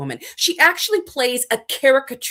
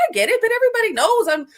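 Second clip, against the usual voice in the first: much higher, fast, breezy brush-off; mock dismissive reply; 9 semitones higher, faster than usual. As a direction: toss it off in a high mocking voice. Imagined dismissive reply, mocking tone.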